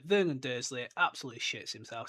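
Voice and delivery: normal talking voice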